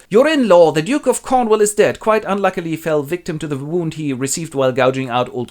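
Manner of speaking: loud high proclamation; announcing messenger. Acted. Messenger brings news of the Duke's death, loud and high.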